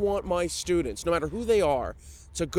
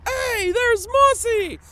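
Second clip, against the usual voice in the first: high, slow, louder greeting call; 6 dB louder, much slower than usual. Retold or imitated. Fans at the booth calling out his name, high and drawn out. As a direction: hail him from across the booth.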